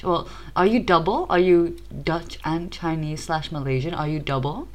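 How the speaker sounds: ordinary talk, nothing marked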